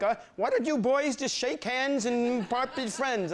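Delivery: high, mild mock principal voice; lightly voiced naive school principal. Principal telling the fighting boys to shake hands, high mock voice. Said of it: "And he's like".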